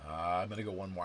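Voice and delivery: normal talking voice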